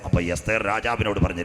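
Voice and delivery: usual speaking voice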